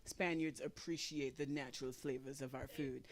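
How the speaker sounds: low, soft snooty voice; imitating a prim Spaniard; mock Spaniard. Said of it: "they like to say".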